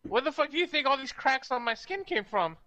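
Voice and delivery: high-pitched indignant question; playful high-voiced exasperation; exasperated protest. In-character quarrel over backstory.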